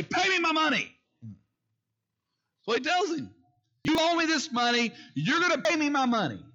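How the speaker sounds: high, loud demand for money; a servant throttling his debtor, angry high shouting. Acted. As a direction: choke the debt out of him, furious. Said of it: "he grabs the dude by the throat".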